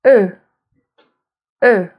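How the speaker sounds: "E" as a rounded "euh" vowel; French e sound. Shown as a demonstration. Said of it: "and the sound".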